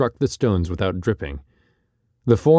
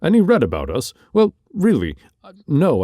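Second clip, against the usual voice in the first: high, fumbling surprise; flustered surprise; 7 semitones higher, 3 dB louder than usual. Acted. Barker flustered by the stranger's question, high and fumbling. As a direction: caught off guard, much higher.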